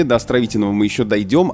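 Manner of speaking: ordinary talk, nothing marked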